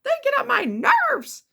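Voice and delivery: calm down a partner with road rage; soothing partner. Retold or imitated. Good partner soothing a road-raging driver, high and coaxing. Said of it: "they're gonna be like".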